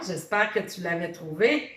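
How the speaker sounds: normal talking voice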